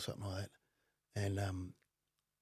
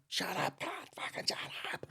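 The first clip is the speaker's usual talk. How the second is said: angry snapping; 7 semitones higher, 8 dB quieter than usual. Retold or imitated. Driver turning on woman passenger, strained angry snapping. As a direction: imitate a strained, furious shut-up, voice thinning out. Driver turns and snaps at her.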